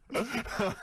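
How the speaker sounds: high laughing burst; a real laugh breaking out; a sudden high laugh at the story; burst of laughter. Real, not acted.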